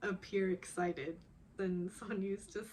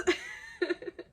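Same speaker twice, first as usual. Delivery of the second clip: real high-pitched squeal of laughter; 4 dB louder than usual. Real, not acted. Fan delighted by the couple, squealing laugh.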